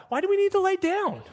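high, hurried impatient voice; cartoonishly high impatient pushing. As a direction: whine like a restless kid eager to go. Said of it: "Here's us".

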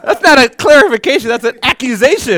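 laughing, wounded mock protest. Real, not acted. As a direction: crack up while protesting. Laughing reply to student's jab.

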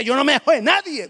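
high, loud bragging; boastful Peter. Acted. Peter bragging to everyone, loud high boast. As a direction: puff up like a tough guy.

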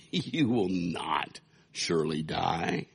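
a lightly voiced serpent, slow and sly. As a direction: coax her slowly like the serpent. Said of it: "Then the serpent said to the woman".

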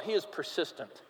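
quiet, intense repetition; hushed emphasis. Acted. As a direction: drop it right down to land the point. Preacher drops to emphasize.

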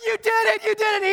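high-pitched jubilant cheer; elated dad's victory cry; yelling celebration. Acted. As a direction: cheer your winning son. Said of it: "He's screaming and yelling".

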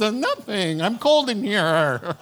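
nervous excuse. Retold or imitated. Haman squirming before the king, nervous excuse. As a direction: fake nonchalance while panicking. Villain caught, feigning calm.

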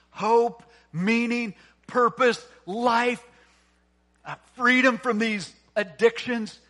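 loud sermon climax, punching single words; impassioned preaching. Real, not acted. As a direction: drive each point home to the congregation. Preaches at full force.

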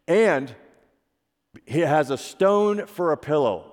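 usual speaking voice

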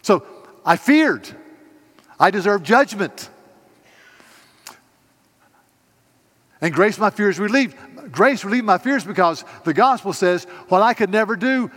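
loud, high, impassioned preaching voice; full-force preaching. Acted. Pastor preaching the hymn's meaning to the congregation, loud and fervent.

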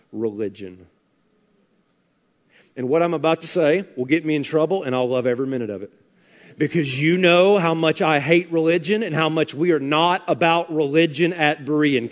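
ordinary talk

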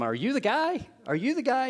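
high, eager questioning from the crowd; eager crowd asking. Retold or imitated. The crowd quizzing John, high and eager. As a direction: pester him like hopeful followers. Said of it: "they would be asking him".

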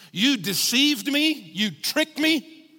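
high, slow, incredulous accusation; Jeremiah unloading on God. Retold or imitated. Preacher as Jeremiah in intense pain, high incredulous accusation. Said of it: "unloads on God".

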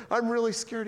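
put-on high-pitched little-kid worry; scared child. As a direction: imitate a frightened kid brother. Retells his childhood fear on wheel.

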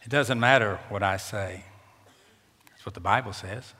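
ordinary talk

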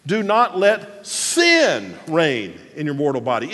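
big emphatic sin; stress on SIN. Shown as a demonstration. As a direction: punch sin, then let the rest fall. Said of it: "it's sin that he's talking about".

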